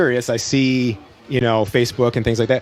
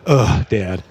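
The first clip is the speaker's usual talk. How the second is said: put-on groaning kids; 6 semitones lower than usual. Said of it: "they just collectively groaned".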